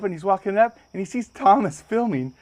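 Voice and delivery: ordinary talk, nothing marked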